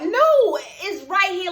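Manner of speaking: high, loud "no" of protest; insistent protest. Retold or imitated. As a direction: push back hard, retelling an argument. Acts out refusing another test.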